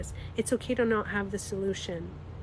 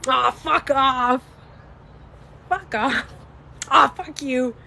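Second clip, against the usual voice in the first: hammed-up cursing at a friend; playful swearing demo; 3 semitones higher, 5 dB louder, slower than usual. Shown as a demonstration. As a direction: toss out an exaggerated, drawn-out brush-off. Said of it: "if I look at you and go".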